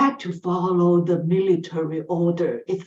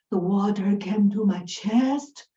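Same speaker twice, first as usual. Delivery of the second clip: usual speaking voice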